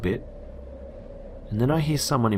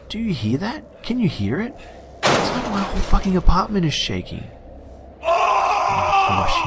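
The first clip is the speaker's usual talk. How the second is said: high, frantic rising panic; panicked; 13 semitones higher than usual. Retold or imitated. Scared tenant tells friend over Skype about the banging, panicked. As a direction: fear building to a cry.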